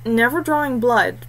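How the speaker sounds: plain reading voice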